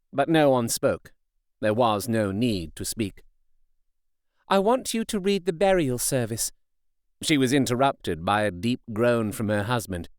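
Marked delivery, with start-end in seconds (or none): 4.5-6.5 put-on lightly raised, earnest young wife; a hint of a resolute young woman; Mina's voice. A narrator's reading. Mina's request.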